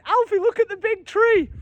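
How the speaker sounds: lightly voiced mum cooing high to her baby; imitating wife showing baby the tree; Lydia calling the baby. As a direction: call out to the little one, excited. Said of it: "I can hear Lydia going".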